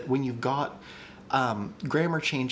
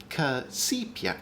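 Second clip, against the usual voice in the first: casipia slow and higher, rising at the end; shifted stress demo; 5 semitones higher, slower than usual. Shown as a demonstration. Host showing stress options, casipia drawn out with a rise. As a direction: stretch casipia out, lifting it upward. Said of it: "So we could do casipia".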